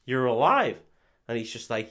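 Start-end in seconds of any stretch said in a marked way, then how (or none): 0.0-0.8 retold amazed cry, a little raised; mock astonishment. Retelling sister finding brother alive, mock astonishment. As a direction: act out a touch of shocked relief.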